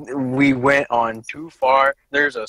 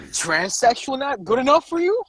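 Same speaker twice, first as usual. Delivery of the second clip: mock indignant; 10 semitones higher than usual.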